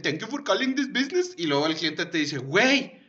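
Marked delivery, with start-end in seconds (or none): 0.0-1.3 high, laughing put-on Indian accent in English; mock Indian call-center agent; laughing fake Indian accent on a support line. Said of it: "hice el acento de un hindú". 2.4-3.0 suspicious caller. Retold or imitated. Caller questions the agent.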